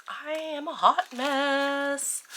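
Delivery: self-mocking groan of a line; drawn-out self-mockery. Real, not acted. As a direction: drag it out in playful exasperation.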